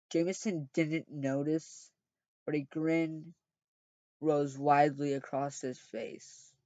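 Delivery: plain reading voice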